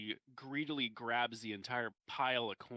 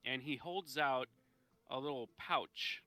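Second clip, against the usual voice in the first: ordinary talk, nothing marked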